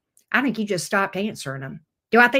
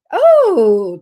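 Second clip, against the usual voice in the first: surprised oh; 4 semitones higher, 10 dB louder than usual. Real, not acted. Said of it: "ten of Wands".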